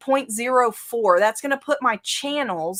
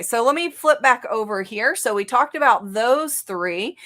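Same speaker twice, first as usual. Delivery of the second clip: normal talking voice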